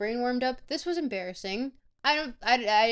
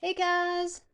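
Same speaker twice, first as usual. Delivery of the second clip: high, bright hey guys; excited greeting; 8 semitones higher, 4 dB louder than usual. Real, not acted. Streamer greeting chat after the break, excited. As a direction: call out happily to chat.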